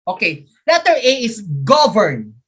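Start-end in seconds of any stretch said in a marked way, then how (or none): 1.6-2.3 GOV punched in "govern", second syllable falling away; first-syllable stress. Shown as a demonstration. Teacher sounding out an option for students, stress on first syllable. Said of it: "first syllable".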